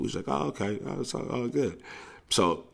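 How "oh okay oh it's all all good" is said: mock casual. Kids playing it cool.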